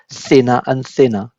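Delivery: usual speaking voice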